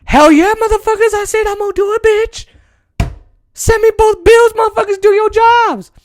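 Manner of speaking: imagined tough Biden. Retold or imitated. What Biden should tell Congress, brash shout. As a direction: bark it like a fed-up boss. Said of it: "instead of being like".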